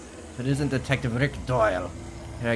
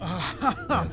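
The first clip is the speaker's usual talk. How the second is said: laughing; 11 semitones higher than usual. Acted. Villain laughs while threatening detective.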